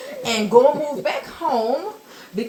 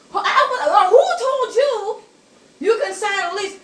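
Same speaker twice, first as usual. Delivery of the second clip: much higher, louder, half-laughing outrage; voice cracking in shock; 11 semitones higher, 5 dB louder than usual. Retold or imitated. Grandma losing her voice over the signed lease, high and laughing. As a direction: squeak it out as the voice gives way. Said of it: "my voice went away".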